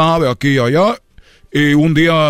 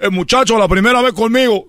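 higher, outraged refusal; mild mock indignation; indignant customer; 8 semitones higher than usual. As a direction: protest in disbelief.

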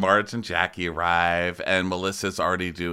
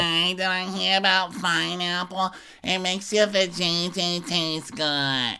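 much higher, slow, brash voice; Teresa impression; 10 semitones higher, slower than usual. Teresa walking into the kitchen, much higher and slow. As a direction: announce it, drawn out. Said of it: "She's like".

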